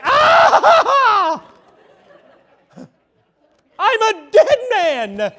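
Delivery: very high, loud, drawn-out scream; terrified scream. Acted. Son caught by his dad at the screen door, screaming. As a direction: scream it out in hammed-up panic. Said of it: "I didn't scream out like that".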